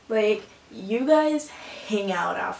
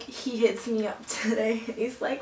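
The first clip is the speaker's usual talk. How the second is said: ordinary delivery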